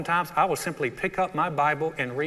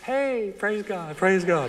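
fake cheer; 3 semitones higher, much slower than usual. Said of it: "you're like".

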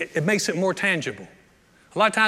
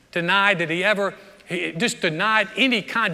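usual speaking voice

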